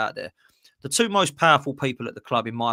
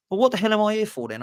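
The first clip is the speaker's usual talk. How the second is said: exasperated; 9 semitones higher than usual. Pretend. Acted dialogue, exasperated hire.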